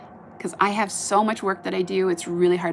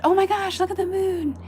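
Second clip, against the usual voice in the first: much higher, thrilled exclamation; excited gasp; 11 semitones higher than usual. Retold or imitated. Retelling spotting the moon after dinner, excited. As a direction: gasp with delight at the sight. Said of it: "I said".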